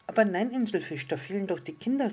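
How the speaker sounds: high, loud, eager protest; little star calling out. A narrator's reading. Little star corrects the island fish, calling out high. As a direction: exclaim it in a small star's high voice. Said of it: "rief das kleine Sterndl".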